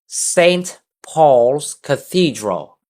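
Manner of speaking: "Saint Paul's Cathedral" slow, stress on "Saint", falling at the end; slow teacher model phrase; model for repeating. Shown as a demonstration. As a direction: say it slowly for the class to echo. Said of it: "practice reading this after me".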